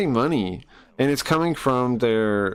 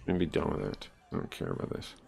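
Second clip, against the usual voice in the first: muttered; 8 semitones lower, 12 dB quieter, faster than usual. Real, not acted. Muttering aside after being called out.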